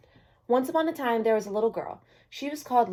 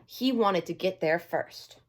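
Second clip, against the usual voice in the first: usual speaking voice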